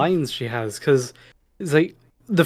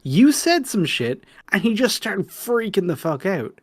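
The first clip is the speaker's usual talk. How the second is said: exasperated rebuke; 8 semitones higher than usual. Acted. Acted exasperated rebuke of David.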